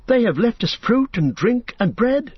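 high, light hobbit voice; lively young hobbit; Pippin. A narrator's reading. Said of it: "said Pippin".

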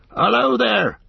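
loud, high hail into the mist; cried out. A narrator's reading. Farmer hailing the unseen rider in the fog, loud. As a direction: call out boldly to the rider. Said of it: "cried Farmer Maggott".

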